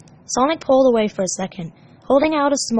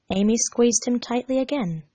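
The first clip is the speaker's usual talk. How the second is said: ordinary delivery, nothing marked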